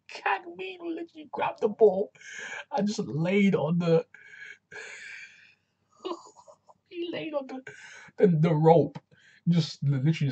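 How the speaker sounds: high, squeaky laughter through the words; laughing hard. Real, not acted. Host retelling the rope blunder, laughing helplessly. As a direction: struggle to speak while cracking up. Cracks up over the rope moment.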